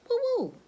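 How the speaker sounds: high, punched little hoot; imitated woo-woo. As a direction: toss in a high woo-woo sound effect. Mimics sound in animal story.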